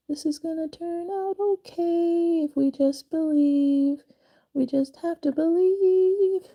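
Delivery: high, sing-song pep talk; sing-song encouragement. Real, not acted. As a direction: coax yourself in a lilting voice.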